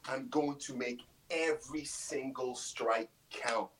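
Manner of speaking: usual speaking voice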